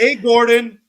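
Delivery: loud, high shout to the host; hammed-up call; mock calling out. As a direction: holler for attention across a kitchen.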